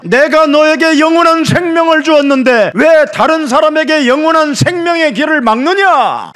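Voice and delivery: high, forceful cry of reproach; thundering rebuke. Acted. Preacher voicing God rebuking those who kill others, thundering. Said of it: "그걸 책망하는 겁니다".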